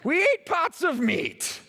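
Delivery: lampooning the grumbling Israelites, high and slow; mocking nostalgic Israelites. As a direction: put on a wistful whiny ex-slave voice, savouring every word. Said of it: "Listen to the way they describe slavery".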